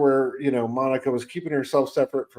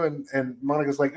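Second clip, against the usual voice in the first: normal talking voice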